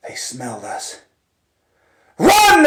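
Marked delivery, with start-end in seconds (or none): 2.2-2.6 very high, loud cry of Run; shouted command. Retold or imitated. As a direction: bark the order in terror. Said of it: "the captain yelled".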